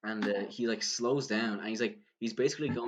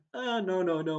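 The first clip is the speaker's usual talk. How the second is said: high, hurried mock backpedalling; flustered denial; 9 semitones higher, 4 dB louder than usual. Caught out by a fan's question, acted flustered denial. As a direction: re-enact wriggling out of a question, pitched way up. Cornered while lying to the stranger.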